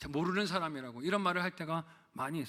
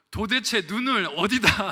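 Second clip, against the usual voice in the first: slow, louder, higher mock retort; mock angry yell; 4 semitones higher, 8 dB louder, slower than usual. Pastor replays the retort he nearly shouted at the driver, mock angry. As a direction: hammer out a half-joking road-rage shout. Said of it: "하고 얘기를 하려고 했는데".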